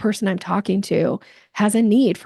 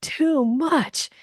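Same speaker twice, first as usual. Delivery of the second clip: mild, higher "ugh" of being put off; disgusted; 6 semitones higher, much slower than usual. Retold or imitated. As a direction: wince at someone too pushy.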